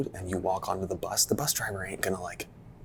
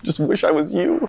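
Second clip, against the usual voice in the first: mock envious whine; 10 semitones higher, 10 dB louder than usual. Mocking envious Americans.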